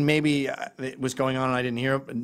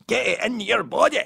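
normal talking voice

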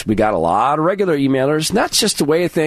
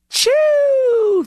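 mocking choo choo. Mocking train sound choo choo.

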